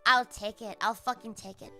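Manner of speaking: normal talking voice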